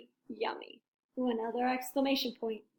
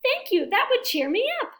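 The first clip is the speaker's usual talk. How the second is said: much higher, louder sweet voice; imagined Piggy; 10 semitones higher, 6 dB louder than usual. Retold or imitated. Elephant imagining Piggy's grateful reply, high and sweet. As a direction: play the grateful friend. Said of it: "she will say".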